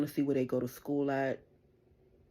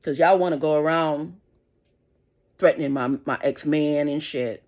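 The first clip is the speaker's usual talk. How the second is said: loud, slowing furious accusation; angry rant; 6 dB louder than usual. Real, not acted. Mother accusing the crew of threats, loud and angry. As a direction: hammer each word at them.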